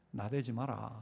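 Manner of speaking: low, quiet Gyeongsang-dialect warning; mock God softly warning in Gyeongsang dialect. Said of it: "경상도 말로 좀". (낮고 조용한 경상도 사투리)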